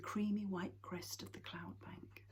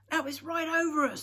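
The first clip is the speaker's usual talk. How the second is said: alarmed; 11 semitones higher, 11 dB louder than usual. Acted. Papa calls over the thunder.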